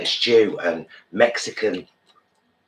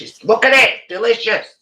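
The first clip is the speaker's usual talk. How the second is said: excited; 6 dB louder than usual. Real, not acted. Showing off the food.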